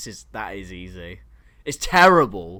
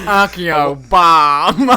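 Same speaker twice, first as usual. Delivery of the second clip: slow, louder, stretched out with laughter; Ron Weasley quote; 8 dB louder, much slower than usual. Acted. Friend quoting a Harry Potter video, slow laughing mock voice. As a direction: quote the meme slowly through a laugh. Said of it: "Stop it, Ron".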